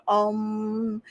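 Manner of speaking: long, held hummed Om; mock meditation chant. Describing meditation she doesn't mean, imitated Om chant. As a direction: drone one Om like a meditator. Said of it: "sitting in the corner with your legs crossed".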